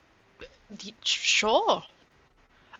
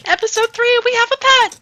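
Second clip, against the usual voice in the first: much higher, louder cheer; excited cheer; 12 semitones higher, 10 dB louder than usual. Real, not acted. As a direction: yell it out in delight. Cheering at getting a pet.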